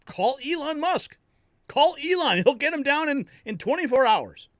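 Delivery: urgent outburst. Retold or imitated. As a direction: squeal it like the obvious fix nobody sees. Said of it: "I'm like".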